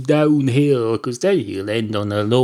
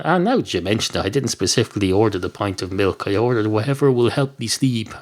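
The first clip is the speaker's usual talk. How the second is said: normal talking voice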